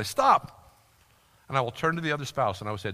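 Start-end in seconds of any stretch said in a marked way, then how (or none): 0.0-0.6 a sharp, raised command to halt; firm stop. Retold or imitated. Counselor interrupting a rambling spouse, sharp stop. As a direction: cut the spouse off short.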